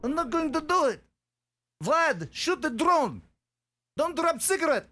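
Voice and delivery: hammed-up Russian accent; terrible Russian accent. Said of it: "That's my terrible Russian accent".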